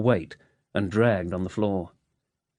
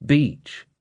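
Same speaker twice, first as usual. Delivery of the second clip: bitter Scottish bark of one word, higher; Scottish accent; 6 semitones higher, 4 dB louder than usual. A narrator's reading. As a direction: echo the word with Scottish contempt. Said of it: "A Scottish accent".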